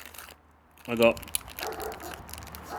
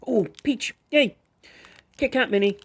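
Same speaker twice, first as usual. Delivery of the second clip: excited; 9 semitones higher than usual. Real, not acted. Finding the peach Kit Kat.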